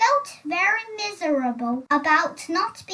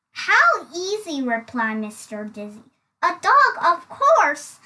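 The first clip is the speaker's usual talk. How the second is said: louder, breezy triumph; confident answer; 5 dB louder than usual. Acted. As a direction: toss off the answer proudly. Dizzy newly confident, louder.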